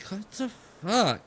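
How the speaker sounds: a lightly voiced confused teenager; bewildered teen. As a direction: daughter following the ribbon, baffled and slow. Daughter finds ribbon, confused.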